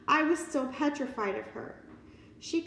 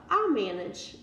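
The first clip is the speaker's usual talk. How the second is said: usual speaking voice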